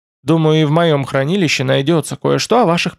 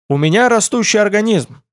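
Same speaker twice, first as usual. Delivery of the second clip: higher, mock-indignant self-defence; snorting retort; 7 semitones higher than usual. A narrator's reading. Young guest defending his appetite to the host, mildly huffy. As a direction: toss it back with a mild huff. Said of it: "фыркнул Тмор".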